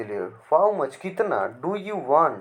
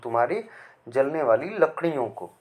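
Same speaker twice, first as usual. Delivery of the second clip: ordinary delivery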